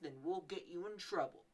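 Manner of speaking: usual speaking voice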